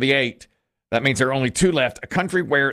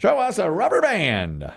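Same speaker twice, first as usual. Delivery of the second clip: higher, slowed host's call; game-show reveal call; 6 semitones higher than usual. Real, not acted. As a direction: stretch the answer out, falling at the end. Host calls for the board reveal.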